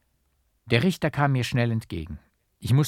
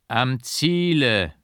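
slow, solemn call, louder and higher; solemn and strong; 4 semitones higher, 6 dB louder, slower than usual. A narrator's reading. The painter turns back and calls solemnly to the monk. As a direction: proclaim it so the vault rings. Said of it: "rief er ... feierlich und stark".

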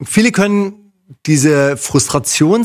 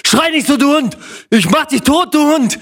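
higher, shouting driver voice; angry driver; 8 semitones higher than usual. Retold or imitated. Driver yelling at the cyclist, mock-angry. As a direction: snap back at him like a furious driver. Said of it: "Darauf dreht er sich um".

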